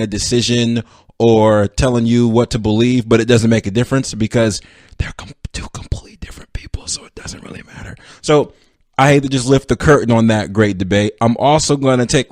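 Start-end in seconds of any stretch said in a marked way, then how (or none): 5.0-7.9 whisper. Acted. Whispered aside about the debate.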